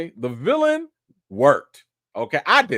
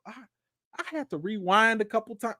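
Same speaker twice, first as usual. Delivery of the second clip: normal talking voice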